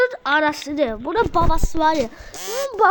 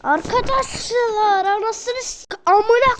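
angry outburst; 5 semitones higher, slower than usual. Real, not acted. Cursing bursts out during challenge.

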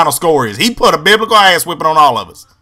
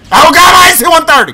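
high panicked cry, "Oh God"; yelling in fake alarm over "one thirty"; mock panicked shout; 10 semitones higher, 4 dB louder than usual. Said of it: "you get killed".

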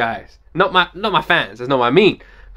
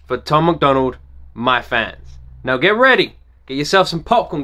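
normal talking voice

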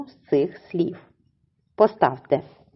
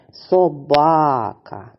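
very slow "Собака", БА stretched: соБАААка; correct second-syllable stress; 2 semitones lower, much slower than usual. Shown as a demonstration. Teacher leads pupils repeating together, middle syllable of "Собака" stressed. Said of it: "Давайте ще раз повторимо разом".

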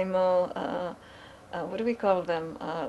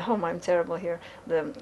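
ordinary talk

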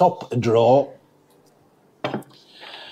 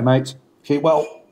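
ordinary talk, nothing marked

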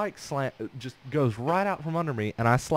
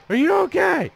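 high, loud imitation of mom; alarmed mom; 6 dB louder than usual. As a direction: cry it out like a panicked mom. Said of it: "my mom was like".

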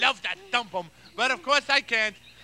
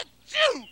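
big sneeze; 12 semitones higher than usual. Acted. The dreaded last sneeze.

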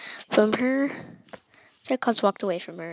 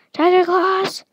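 loud, high summoning call; 8 semitones higher, 7 dB louder than usual. A narrator's reading. Zeus summons Tiger Claws, calling out.

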